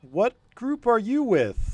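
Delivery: high, slow German guard question; suspicious guard. Acted. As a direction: ask it like a wary sentry.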